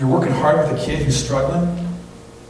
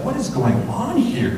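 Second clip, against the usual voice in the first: bewildered; 10 semitones higher than usual. Retold or imitated. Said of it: "You're like".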